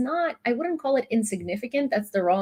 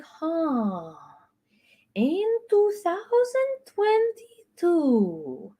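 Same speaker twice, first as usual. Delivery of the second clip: mock Eastern European accent; slower than usual. Parodying trolls with a slow mock Eastern European accent. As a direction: ham up a thick Eastern European accent, drawn out. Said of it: "I'm mocking an Eastern European accent".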